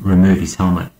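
plain reading voice

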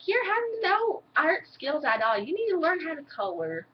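lofty put-down of a student; mock art teacher. Imitating the art teacher scolding her coloring. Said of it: "she'd be like".

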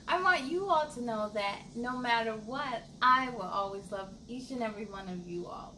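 ordinary delivery

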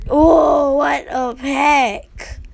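amazed groan. Real, not acted. Pack opening reaction, drawn out.

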